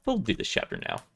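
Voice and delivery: usual speaking voice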